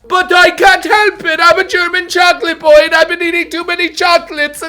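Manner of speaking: high, loud goofy character voice; mock fat boy voice. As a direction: whine as the chocolate boy. Playing the chocolate boy himself.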